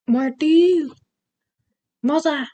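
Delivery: put-on high-pitched Scottish accent call; Brave film impression. Quoting the animated film Brave, high Scottish accent. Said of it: "That's from Brave".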